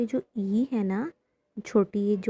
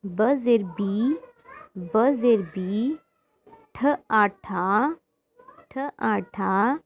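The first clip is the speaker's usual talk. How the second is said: slow spelling-out; slower than usual. Shown as a demonstration. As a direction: spell each syllable very slowly, letter then vowel mark then sound. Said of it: "इसको पढ़ते हैं". (अक्षर-अक्षर जोड़कर बहुत धीरे पढ़ाना)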